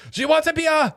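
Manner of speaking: very high, hammed-up yell; mock-furious German server shouting an order; yelling German woman. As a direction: yell it like Frau from Austin Powers. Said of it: "just start yelling at him".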